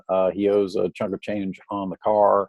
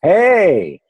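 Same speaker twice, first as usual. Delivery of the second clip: calling out; 10 semitones higher, 7 dB louder than usual. Retold or imitated. Mock tweet call-out.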